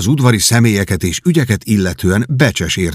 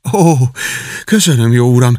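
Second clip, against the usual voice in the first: grateful cry; 4 semitones higher, 3 dB louder than usual. A narrator's reading. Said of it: "kiáltotta a fiú".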